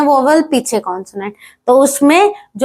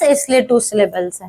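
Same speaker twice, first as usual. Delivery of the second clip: ordinary talk, nothing marked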